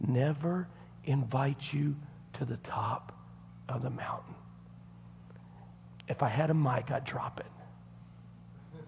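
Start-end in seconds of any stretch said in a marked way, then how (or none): 0.1-4.3 whisper. Acted. Dramatic whispered punchline. 6.9-8.8 slow whispered aside. Acted. Mic drop aside.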